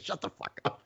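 whispered, retold brush-off; mock whisper from friend; muttered retort. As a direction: hiss it back under breath. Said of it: "He's like".

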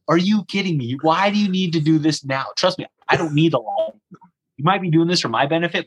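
outraged at being woken at three in the morning; exasperated disbelief. Retold or imitated. Guard changing bulb at 3 am.

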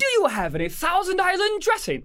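very high, put-on haughty request; mock entitled diner. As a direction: ask grandly in a squeaky mock-royal voice. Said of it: "sitting in, like, her throne chair".

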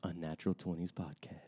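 hushed, laid-back low radio patter; smooth radio host. Acted. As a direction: murmur it like a late-night radio host. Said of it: "I like to listen to the waves".

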